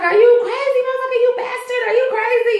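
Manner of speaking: high, furious screech; shrieking anger. Retold or imitated. Choked woman screaming at her attacker in high fury. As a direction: scream at him, outraged. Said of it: "And she's like".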